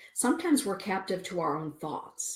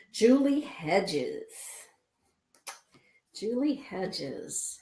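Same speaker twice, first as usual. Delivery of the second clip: very slow, louder name call; drawn-out name call; 2 semitones higher, 3 dB louder, much slower than usual. Real, not acted. Greeting a viewer on her live show, drawn-out name call. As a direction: stretch the name out.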